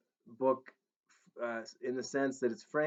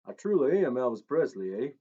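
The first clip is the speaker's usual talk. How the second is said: louder Tennessee twang, pushing the claim; 2 semitones higher, 6 dB louder than usual. Acted. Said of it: "he insisted".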